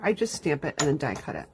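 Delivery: usual speaking voice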